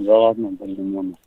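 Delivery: normal talking voice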